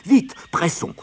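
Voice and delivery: high, loud hurry-up; urgent cry. A narrator's reading. Bear hurrying companions before the rabbit returns, crying out. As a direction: cry out to rush them. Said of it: "Père Ours s'écria".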